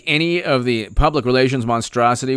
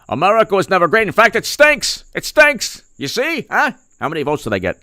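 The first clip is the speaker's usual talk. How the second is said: mock politician rant; 4 semitones higher than usual. Mocking Cuomo, rising.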